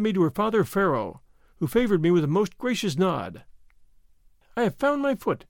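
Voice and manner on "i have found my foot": high, joyful princess cry; frantic joy. A narrator's reading. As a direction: cry it out in giddy delight. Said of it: "clapping her little hands together with every sign of frantic joy".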